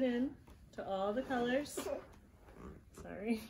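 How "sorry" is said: quick whispered sorry; whispered aside. Real, not acted. Mother whispering sorry to her son, softly. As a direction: murmur it under your breath. Mom apologises quietly aside.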